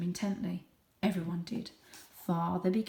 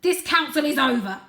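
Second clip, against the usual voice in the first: loud, final command; commanding father; 7 semitones higher, 11 dB louder than usual. Acted. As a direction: end it with authority. Father ends the council.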